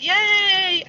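a high, hollow mock cheer; sarcastic yay. Mock cheer about the royal wedding, high and hollow. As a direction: fake the cheer, then drop it. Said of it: "I'm not really excited about this one".

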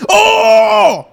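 a high, loud, delighted cry; excited shout. Retold or imitated. Recalling the movie kiss, a high loud excited cry. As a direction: cheer as the two finally kiss. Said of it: "Because I was excited".

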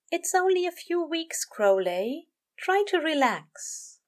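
high-pitched gentle coaxing; reassuring angel. A narrator's reading. Aziraphale tells weary Crowley to relax, reassuring. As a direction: pitch up, slowing into the last words.

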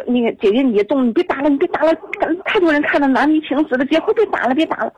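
urgent pleading, retold. Retold friend pleading to stop a fight.

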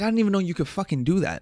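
fast, high astonished outburst; surprised. Retold or imitated. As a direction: blurt it out amazed. Stunned reaction.

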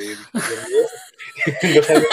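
laughing. Real, not acted. Laughing at the ChatGPT joke.

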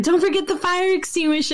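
imitated little boy, high and quick; Charlie. Son answering dad's oven remark, imitated high child voice. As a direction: toss back a high, rapid kid's comeback. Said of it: "And then Charlie's like".

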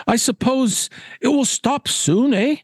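high-pitched, fussy gentleman; hopeful Caswell. Acted. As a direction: fish for agreement as a pompous angler. Caswell hopes for a break.

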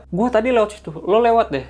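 high, loud retelling of a scared friend; retold fright. Frightened friend to pantry colleagues, retold scared. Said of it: "takut tuh, dia mau masuk".

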